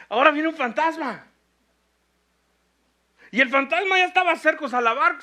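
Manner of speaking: loud, high, fervent preaching; full-volume sermon build; preaching at full force. Acted. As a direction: drive the storm scene home to the church.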